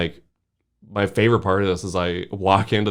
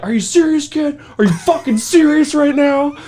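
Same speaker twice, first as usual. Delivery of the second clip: mock outraged cop, much higher and louder; furious cop; 5 dB louder than usual. The cop yelling at the fleeing kid, furious mock disbelief. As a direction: play the angry cop in disbelief. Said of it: "cop is furious".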